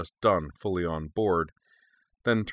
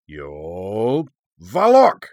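thunderous incantation; 9 dB louder, much slower than usual. A narrator's reading. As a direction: boom out the ancient words like thunder. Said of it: "with a sound like thunder".

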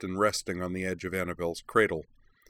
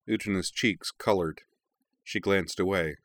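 ordinary delivery

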